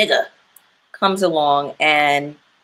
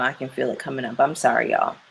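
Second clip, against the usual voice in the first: tearing up; 5 semitones lower, 5 dB quieter, faster than usual. Real, not acted. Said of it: "I don't wanna get emotional".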